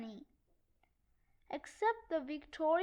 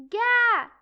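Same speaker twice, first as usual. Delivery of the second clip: loud strangled cry of exasperation; frustrated yell; 5 semitones higher, 12 dB louder than usual. Acted. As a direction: explode with a short frustrated shout. Exasperated outburst at Francis.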